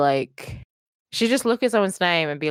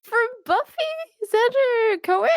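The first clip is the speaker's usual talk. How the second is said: mock confused; slower than usual. Mocking confused guess.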